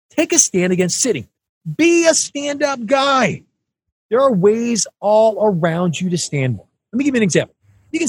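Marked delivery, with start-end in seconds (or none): 0.1-3.4 punchy slogan. Acted.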